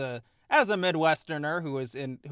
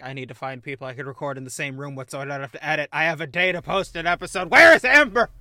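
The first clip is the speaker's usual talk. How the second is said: mounting panic; 9 dB louder, faster than usual. Retold or imitated. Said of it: "and I was like".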